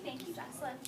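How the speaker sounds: ordinary talk, nothing marked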